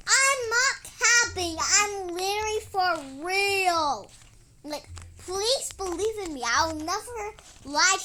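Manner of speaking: loud earnest protest; insistent protest. Acted. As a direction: protest that you're telling the truth. Insisting she isn't lying.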